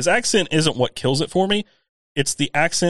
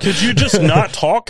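exasperated retort; 7 semitones higher, 5 dB louder than usual. Acted. Exasperated at podcast cohost.

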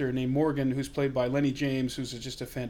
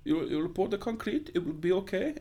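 put-on Welsh accent; 5 semitones higher than usual. Friend mimics Tom Hardy's character in Locke with a Welsh accent. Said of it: "he went for a Welsh accent".

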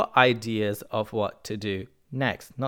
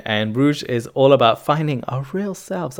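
ordinary talk, nothing marked

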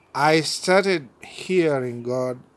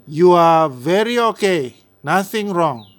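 loud, high Indian doctor voice; Indian accent; 4 semitones higher, 9 dB louder than usual. Retold or imitated. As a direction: imitate the Indian doctor's English. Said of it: "in their Indian accent".

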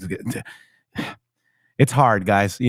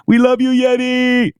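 imitating Zach yelling; 9 semitones higher than usual. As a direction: play a fan shouting at the screen. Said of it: "That's Zach right now, uh, yelling at".

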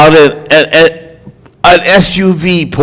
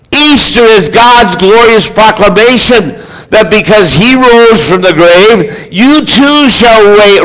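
high, emphatic pulpit proclamation; fervent preaching; 4 semitones higher than usual. Real, not acted. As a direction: proclaim it to the whole congregation, punching key words. Preaches the climax.

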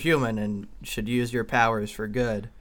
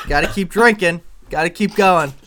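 loud, high cheering; 6 semitones higher, 9 dB louder than usual. Acted. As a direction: shout encouragement to keep going. Cheering her on to drink.

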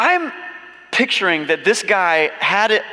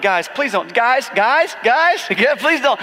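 nervous plea; 7 semitones higher, slower than usual. Retold or imitated. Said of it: "He might have been the whole time like".

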